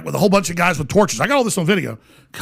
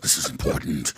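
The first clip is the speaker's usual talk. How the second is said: unvoiced whisper, very soft; imitated hushed shushing reply; hushed reverence; 8 dB quieter than usual. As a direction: whisper it like an awed onlooker. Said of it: "They're like".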